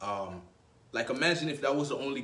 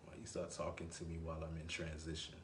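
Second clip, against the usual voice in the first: much lower and softer, flat echo; low mocking echo; 8 semitones lower, 14 dB quieter than usual. Shown as a demonstration. Man echoing a girl's text back to her, low and mocking. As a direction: mutter her words back at her, unbothered. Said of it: "I'll probably just say".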